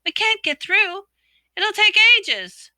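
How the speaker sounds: high, loud desperate call. A narrator's reading. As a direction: call out in despair. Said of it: "sounding desperate".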